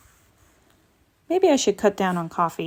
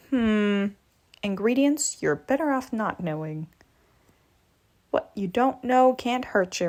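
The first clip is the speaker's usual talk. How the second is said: usual speaking voice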